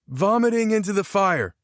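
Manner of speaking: high-pitched yelled guess. A narrator's reading. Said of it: "Tanner yelled".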